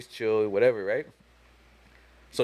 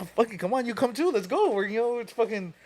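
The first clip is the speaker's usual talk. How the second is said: high-pitched hype, growing louder; excited recruiting; 10 semitones higher, 7 dB louder than usual. Retold or imitated. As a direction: wave a friend in, building toward laughter. Acts out inviting Omar along.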